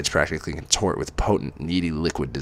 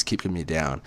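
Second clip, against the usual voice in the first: usual speaking voice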